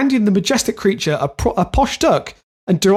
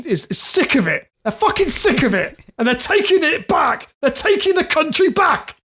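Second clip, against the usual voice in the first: mock-populist rant, pitch climbing higher; mock outrage; 9 semitones higher than usual. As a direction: build into a hammed-up protest as a fed-up campaigner. Acting out angry anti-swan campaigner.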